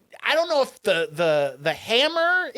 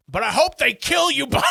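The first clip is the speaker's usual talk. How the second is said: impassioned outburst; 5 semitones higher than usual. Retold or imitated. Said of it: "a really impassioned, great speech".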